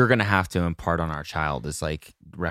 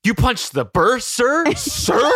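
loud, much higher, slow, breaking into laughter; laughing yell; 5 dB louder, slower than usual. Acted. Burr shocked at Hamilton's confession, yelling through laughter. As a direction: belt the line while cracking up. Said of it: "I love you're like".

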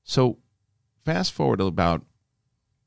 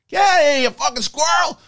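high, loud shout to chase off a squirrel; furious shout at the thieving squirrel; screaming at a squirrel; 6 dB louder than usual. Retold or imitated. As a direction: yell it out mid-call, shrill and sudden. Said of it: "I start screaming at a squirrel".

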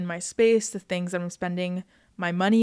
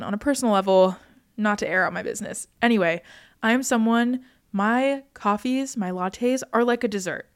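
normal talking voice

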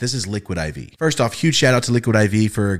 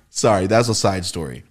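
ordinary talk

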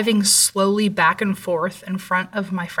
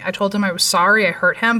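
plain reading voice

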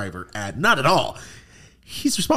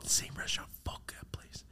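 a quick secret curse, voiceless; hushed cursing aside; whispered aside; 20 dB quieter than usual. Real, not acted. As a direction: whisper it under your breath. Muttered aside mid-story.

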